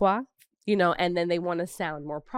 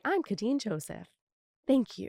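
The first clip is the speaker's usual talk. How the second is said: polished, softer pageant introduction with a lifted thank-you; 5 semitones higher, 5 dB quieter than usual. Retold or imitated. Contestant introducing herself on the pageant stage, polished and proper. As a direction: present yourself neatly to the judges. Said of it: "when I had to get on pageant, it's like".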